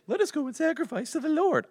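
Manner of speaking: very high, loud mimicry; mock their request in a silly high voice; exaggerated, jeering falsetto imitation; Pharaoh's mocking voice. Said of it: "I don't know if he actually said it in that voice".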